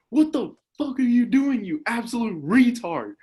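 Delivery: loud high angry yell; retold yell. Yelling after tripping in gym, loud and high. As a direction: shout it at the kid in anger. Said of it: "I yell, like".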